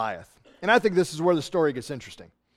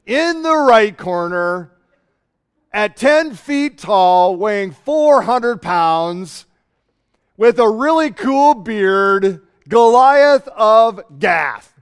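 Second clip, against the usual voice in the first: slow, drawn-out ring announcer, louder; boxing announcer; 3 semitones higher, 6 dB louder, slower than usual. Retold or imitated. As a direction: call it like a ring announcer hyping the big fighter. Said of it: "you can almost hear the announcer".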